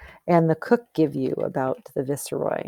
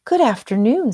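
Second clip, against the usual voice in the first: bright, raised polite greeting, higher and louder; polite little rooster; 5 semitones higher, 5 dB louder than usual. Acted. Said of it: "said Half Chicken to the guards".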